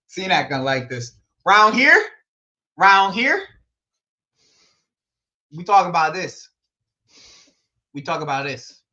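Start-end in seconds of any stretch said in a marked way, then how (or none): 1.4-3.4 hammed-up Texas twang, high and loud; mock Texan country voice, lifting at the end; country accent. Shown as a demonstration. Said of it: "do my best country accent". 5.5-8.8 put-on Texas country twang; mock Texan talk; country accent. Shown as a demonstration. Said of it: "do my best country accent".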